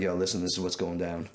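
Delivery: low, no-nonsense confronting voice; switched serious voice. Retold or imitated. Buyer confronts the guitar thief, voice switched to low and serious. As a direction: drop the voice and lay down the law. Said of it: "I switch voices right away".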